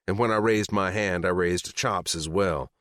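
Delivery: usual speaking voice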